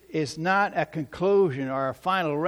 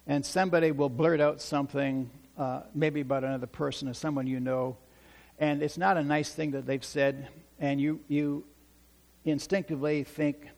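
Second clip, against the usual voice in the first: usual speaking voice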